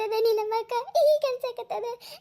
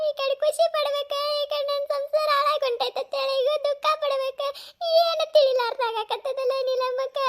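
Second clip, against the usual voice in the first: tearful wailing; 2 semitones higher than usual. Acted. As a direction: cry out in helpless despair, stretching the phrase ends. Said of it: "ಹಿಂಗ್ ಅಳ್ಕೋತ ಕೂತು".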